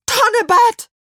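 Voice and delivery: high, loud cry; urgent witch casting a spell; shouted spell. A narrator's reading. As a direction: fling the magic word out at full voice. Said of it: "und rief".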